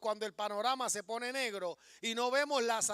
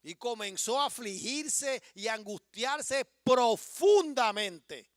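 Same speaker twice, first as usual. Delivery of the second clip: slow, louder scripture reading; emphatic reading; 5 dB louder, slower than usual. Acted. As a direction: lean on every word of the verse. Reread slowly with emphasis.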